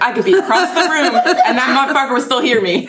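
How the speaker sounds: high-pitched, laughing macho boast; mock manly boast. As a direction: imitate someone bragging about how far his voice carries. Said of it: "just tests, like, his manliness".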